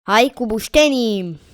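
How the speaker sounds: rallying cry. Real, not acted. Player decides to build with logs, rallying cry. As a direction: call it out like a cheer. Louder repeat of muttered decision.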